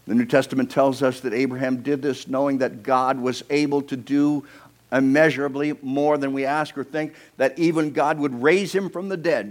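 loud, high, emphatic preacher; impassioned preaching. Acted. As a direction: preach it with rising conviction. Preaching at full force.

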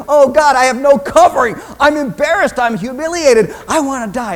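high, loud acted humiliation; hammed-up anguished embarrassment; mortified outcry. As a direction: cry out as if caught exposed. Acts out being caught naked.

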